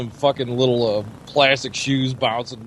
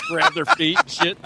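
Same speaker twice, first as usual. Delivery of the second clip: laughing. Real, not acted. Laughing while telling.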